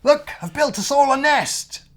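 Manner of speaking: high, loud and proud; proud crocodile. Acted. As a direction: announce it proudly. Crocodile announces nest.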